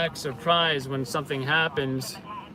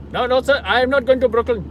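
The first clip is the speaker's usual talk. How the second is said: put-on much higher, loud, fast accented refusal; refusing cabbie imitation; 10 semitones higher, 9 dB louder, faster than usual. Cabbie refusing a rider bound for Brooklyn, loud accented protest. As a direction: play an indignant cab driver, pitched up and rapid. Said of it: "they'll respond with something like".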